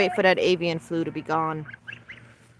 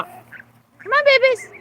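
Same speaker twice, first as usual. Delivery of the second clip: sing-song coaxing to a bird; high coaxing pet voice; 4 dB louder, slower than usual. Real, not acted. Owner coaxing her parrot to come see babies, high sing-song. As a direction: coo high and bright to coax the bird out.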